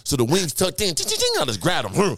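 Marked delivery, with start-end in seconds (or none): 0.9-1.4 high-pitched ching effects; high chime noises. Retold or imitated. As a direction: ring out the metal wings. Wings tucking sound effect.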